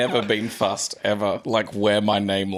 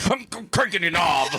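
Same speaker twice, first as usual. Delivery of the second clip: goofy improv voice; 9 semitones higher than usual. Retold or imitated. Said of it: "then he's like".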